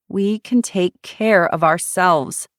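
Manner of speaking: slow and firm, insisting. A narrator's reading. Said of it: "Shiloh insisted".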